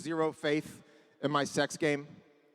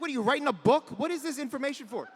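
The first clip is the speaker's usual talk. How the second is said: exasperated son; 8 semitones higher than usual. Retold or imitated. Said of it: "I'm like".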